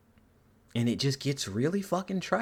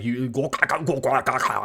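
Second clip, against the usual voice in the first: mock alien language; 4 dB louder than usual. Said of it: "if he said that in, like, Yautja".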